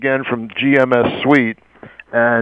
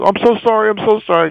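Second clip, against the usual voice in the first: higher voice, pleading as if shaking; frantic florist; 9 semitones higher, faster than usual. Retold or imitated. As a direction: imitate a frantic, high-pitched apology. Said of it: "frantic woman from the florist".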